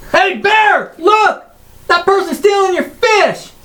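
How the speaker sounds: put-on high kid voice yelling to a bear; shouting a tattle, high and bright; kid calling out.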